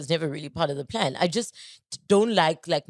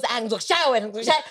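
higher mock whine of excuse; pitched-up, hammed-up protest; imitated brother's excuse; 5 semitones higher, 3 dB louder, faster than usual. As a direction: mimic a sibling dodging chores. Imitates brother dodging chores.

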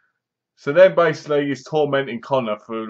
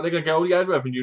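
rushed imagined quote; 3 semitones higher, much faster than usual. Retold or imitated. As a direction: rattle off "They're gonna get all the ad revenue" very fast. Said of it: "I was like".

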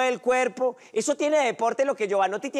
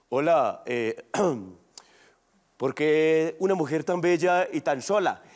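sensual voice; 6 semitones lower than usual. Retold or imitated. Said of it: "Y usted va con voz sensual".

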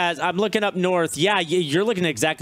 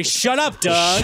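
mock insult; 4 semitones higher, 4 dB louder, slower than usual. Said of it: "I'm so hungry".

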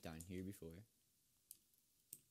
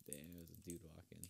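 ordinary delivery

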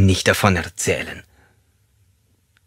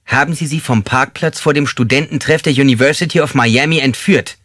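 raised, intimidating voice; demanding interrogation; 6 semitones higher, 4 dB louder than usual. Acted. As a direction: press the accusation hard, pitch up. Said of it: "Mit erhobener Stimme in forderndem, angsteinflößendem Ton".

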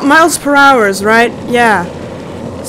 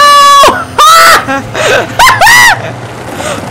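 shrieking laugh; 10 semitones higher than usual. Real, not acted. Screaming laugh on a ride.

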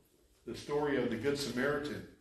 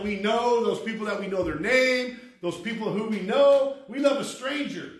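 raised, louder scripture preaching; forceful preaching; 8 semitones higher, 9 dB louder than usual. Real, not acted. Preacher urging the congregation to love strangers, emphatic preaching. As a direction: drive the verse home from the pulpit.

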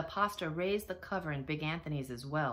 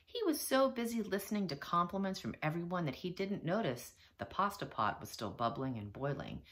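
ordinary delivery

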